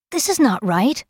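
high, tightly held objection. A narrator's reading. Kel protests the mistreatment to Gower, tightly. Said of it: "Kel told Gower tightly".

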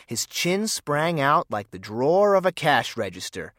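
usual speaking voice

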